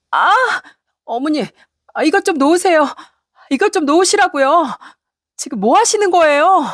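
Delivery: high-pitched, distressed plea to let go; alarmed protest. Acted. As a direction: cry out at being grabbed, acted. Acted hair-pulling, wife protests. (다급하게 높은 목소리로 항의)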